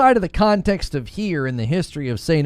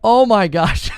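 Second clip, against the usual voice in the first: higher, louder outburst breaking into a laugh; mock exasperation; 6 semitones higher, 5 dB louder, slower than usual. Streamer re-enacts reacting to nagging viewers, mock exasperation. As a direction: throw it out exasperated, then laugh.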